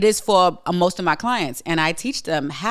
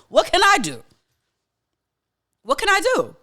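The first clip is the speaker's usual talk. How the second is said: much higher, louder desperate what can I do; exasperated outcry; 13 semitones higher, 4 dB louder than usual. Real, not acted. Venting about politics to viewers, high desperate outcry. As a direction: cry out helplessly, voice climbing.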